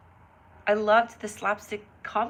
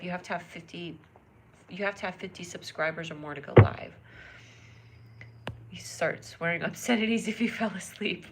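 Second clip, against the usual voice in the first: ordinary talk, nothing marked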